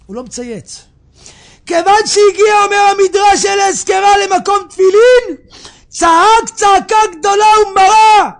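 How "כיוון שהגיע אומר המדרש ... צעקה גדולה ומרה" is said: high, loud shouted telling of the climax; full-force preaching. Acted. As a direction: cry it out to the whole congregation. (דרשה בצעקה, במלוא העוצמה)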